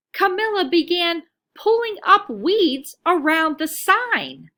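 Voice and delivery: ordinary delivery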